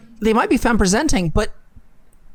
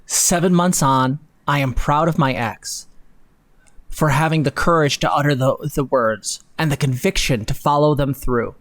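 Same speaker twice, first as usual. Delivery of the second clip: dramatic essay reading; 5 semitones lower than usual. Acted. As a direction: read it like a heartfelt confession. Reading the ex's essay aloud.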